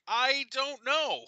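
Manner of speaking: put-on high, slow coy girl; coy child voice. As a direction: drag it out innocently. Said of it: "She's like".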